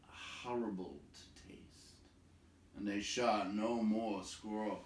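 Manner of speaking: usual speaking voice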